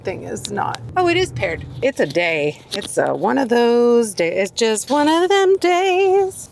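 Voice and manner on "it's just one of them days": jokey high voice complaining about her day; put-on folksy voice. Shown as a demonstration. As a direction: ham up the weary saying. Repeats phrase in put-on voice.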